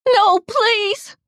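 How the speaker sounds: very high, loud plea; panicked, high-pitched pleading; terrified begging. A narrator's reading. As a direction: beg desperately, voice shooting up. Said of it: "I begged".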